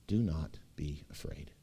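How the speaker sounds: hushed whisper; whispered to the congregation; whispered comfort. Acted. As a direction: breathe it like a secret comfort. God's words to the congregation.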